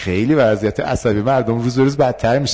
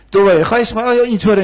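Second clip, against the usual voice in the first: much higher, louder begging voice; pleading student; 11 semitones higher, 6 dB louder, faster than usual. Acted. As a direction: whine like an insistent student. Student begging role-play. (دانشجوی ملتمس با صدای خیلی زیر)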